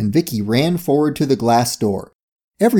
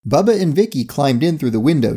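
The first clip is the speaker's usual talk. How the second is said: ordinary delivery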